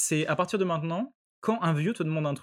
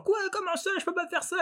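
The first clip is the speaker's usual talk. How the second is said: mock old lady outrage; 3 dB quieter than usual. Old woman thrown out of the library, high mock protest. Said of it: "La vieille, elle hurle".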